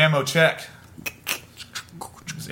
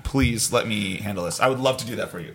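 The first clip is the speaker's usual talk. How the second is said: soft pleading; 5 semitones lower, 4 dB quieter than usual. Acted. Pleading softly to handle.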